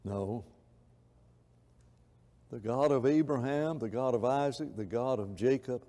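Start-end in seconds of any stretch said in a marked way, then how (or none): none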